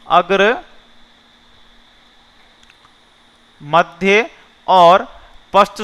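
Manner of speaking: slowly spaced out, long pause after अग्र; slow listing. Shown as a demonstration. Teacher slowly spells out vowel classes for students. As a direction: spell out अग्र, मध्य, पश्च one by one, slowly. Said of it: "ये पता होगा आपको".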